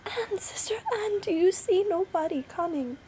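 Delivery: very high, anxious call; wife crying out. A narrator's reading. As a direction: call up to your sister in hope. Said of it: "and then she cried out".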